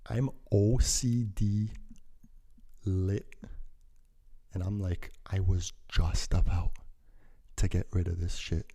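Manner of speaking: ordinary talk, nothing marked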